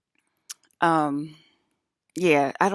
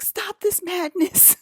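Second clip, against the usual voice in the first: dramatic plea; 4 dB louder, slower than usual. Retold or imitated. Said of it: "she'd be like".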